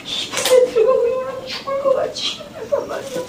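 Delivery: high, tearful wail of a worn-out miner; despairing sob-laden lament, pitched high; anguished father crying. Retold or imitated. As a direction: cry it out, high and strained, as the broken father. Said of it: "이제 얘가 아버지가 된 거예요".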